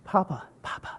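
soft unvoiced whisper of Papa, Papa. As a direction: breathe it like a secret. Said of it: "Actually, they whisper".

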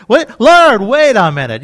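loud, urgent interruption of the Lord; alarmed protest. Acted. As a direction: break in loudly, pleading for a hearing. Pleading with the Lord at judgment.

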